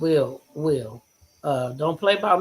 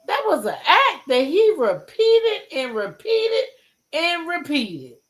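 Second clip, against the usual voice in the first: furious shout; 3 dB louder than usual. Real, not acted. Streamer yelling at a mother defending her cheating son, furious. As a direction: shout it like you're outraged at the screen.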